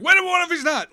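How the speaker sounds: high, loud mock panic; sudden alarm. Relief turns to doubt over Riker, mock panic. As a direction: blurt it in hammed-up alarm.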